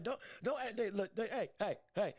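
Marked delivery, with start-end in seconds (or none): none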